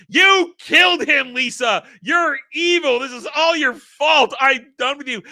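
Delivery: loud, high outraged yelling; overacted angry tirade; hammed-up accusing shout. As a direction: hurl the blame in mock fury. Mark accuses Lisa over the death.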